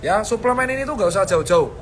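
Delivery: usual speaking voice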